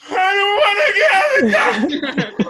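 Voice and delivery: hammed-up tearful shriek; inner wail. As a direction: scream it like a panicked child. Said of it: "on the inside, I was like".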